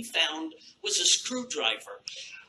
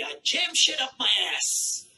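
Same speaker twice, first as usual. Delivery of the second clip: a put-on bragging voice, a bit higher and louder; mock bragging guy; 6 semitones higher, 4 dB louder than usual. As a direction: brag about it like a proud dude.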